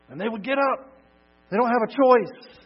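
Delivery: very high, loud preaching climax; excited exclaiming. Real, not acted. Preacher marvelling that the dead must rise, shouting excitedly. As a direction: cry it out in exultant amazement.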